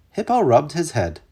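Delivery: ordinary delivery, nothing marked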